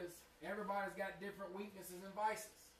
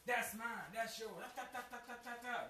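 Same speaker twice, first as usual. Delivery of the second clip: mock bickering; 6 semitones higher than usual. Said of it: "Open bickering".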